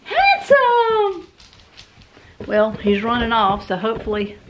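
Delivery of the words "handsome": very high cooing pet voice. Real, not acted. Owner coaxing her dog to walk, very high cooing. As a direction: sweet-talk the dog in a tiny high voice.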